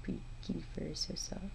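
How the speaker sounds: hushed, breathy coo to a cat; soft cooing whisper. Retold or imitated. Retelling what she told her cat while petting, soft cooing whisper. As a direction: whisper it like stroking soft fur. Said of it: "And I was like".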